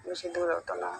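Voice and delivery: ordinary delivery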